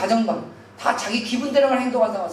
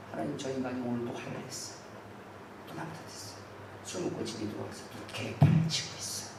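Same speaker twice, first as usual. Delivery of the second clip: soft, half-whispered complaint to God; hushed tattling prayer; 5 semitones lower, 11 dB quieter than usual. Acted. Wife tattling on her husband to God, hushed and soft. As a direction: murmur the complaints under your breath. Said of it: "하나님께 일러 바치는 거예요".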